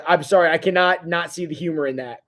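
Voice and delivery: usual speaking voice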